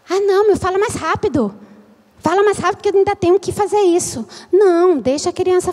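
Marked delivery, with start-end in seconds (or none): 0.1-4.3 higher, rapid-fire impatience; impatient hurrying. Acted. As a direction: rush the child along. Impatient adult hurrying slow child.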